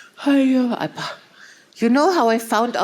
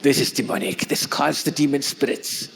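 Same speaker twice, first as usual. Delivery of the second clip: lower, ominous mock warning; mock weird lady; 6 semitones lower than usual. Wife imitates a South African sister calling the chime demonic. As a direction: deliver the warning deep and ominous. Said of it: "She said".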